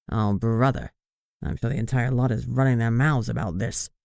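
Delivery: sag into it, fed up and worried; groaning. A narrator's reading. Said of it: "Nelson groaned".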